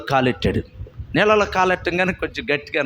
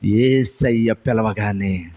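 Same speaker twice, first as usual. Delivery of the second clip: usual speaking voice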